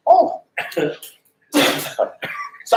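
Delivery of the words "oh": very high, loud "oh"; stunned excitement. Retold or imitated. Leaving after the secret offer, high stunned yelp. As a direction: yelp it in mock astonishment.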